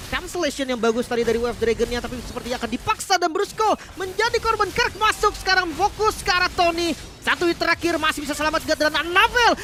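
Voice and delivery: high, loud esports call; excited play-by-play. Real, not acted. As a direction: build up the fight with hype. Live play-by-play of teamfight. (komentar penuh semangat)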